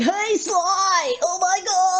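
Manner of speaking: high panicked nerd shout; frantic high-pitched nerd yelling. Retold or imitated. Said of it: "he's always panicked about something".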